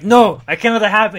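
loud, high heroic refusal; defiant hero. Acted. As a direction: shout it out like a hero refusing defeat. Hero defiant while being crushed.

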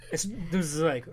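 ordinary talk, nothing marked

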